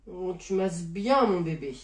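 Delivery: slow, low, softened coo; cooing praise. Acted. Partner flattering the offended masseur, cooing. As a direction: melt into the massage, stretching the praise. Placating partner during massage.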